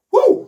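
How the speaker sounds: loud high whoop; excited whoop. Real, not acted. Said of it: "Je suis super excité". (cri de joie aigu)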